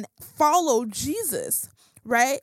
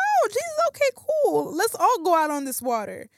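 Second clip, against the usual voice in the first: very high, squeaky burst of delight; hammed-up eager follower, pitched way up; acted eager excitement; 9 semitones higher than usual. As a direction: start sky-high and thrilled, easing down as it goes. Acting out the disciples' eager reply.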